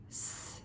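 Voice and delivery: "S." a voiceless hiss, no vowel; just the hiss of "S."; isolated s sound. Shown as a demonstration. Teacher naming the first sound of sod, hissed "S.". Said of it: "What's the first sound?".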